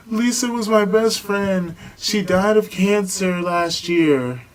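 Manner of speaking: high, loud, slow imitation of a crying girl; mock sobbing. Friend tells the party her best friend died, mock sobbing wail. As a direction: wail it like a grieving friend, hammed up. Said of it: "crying and getting, like, all emotional, damn near sobbing".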